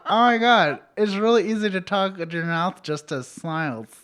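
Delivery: mock amazed discovery. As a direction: exclaim it as a gleeful revelation. Quoting own amazed discovery, laughing.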